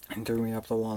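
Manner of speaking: ordinary talk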